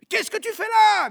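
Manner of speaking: loud, high-pitched shouted question; alarmed calling out. Acted. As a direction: call sharply to someone out in the street. Comrade calls out to Gavroche.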